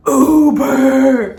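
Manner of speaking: loud, high, drawn-out holler; imitated yell. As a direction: holler it long, imitating her friend. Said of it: "she just yells".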